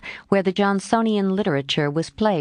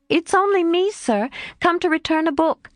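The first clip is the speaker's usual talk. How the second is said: much higher, innocent bland tone; 11 semitones higher than usual. Acted. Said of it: "she said blandly".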